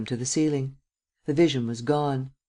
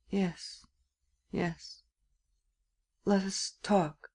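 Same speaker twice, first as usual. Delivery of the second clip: resigned and soft; 5 semitones higher, 8 dB quieter, much slower than usual. Acted. Resigned young man.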